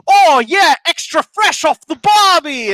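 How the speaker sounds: loud, high hammed-up Australian accent; mock Australian accent, loud and high. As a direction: overdo the Aussie. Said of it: "in Australian accents".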